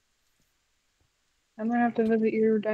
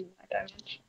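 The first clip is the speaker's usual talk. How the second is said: whisper; 11 dB quieter than usual. Acted. Warning the other player.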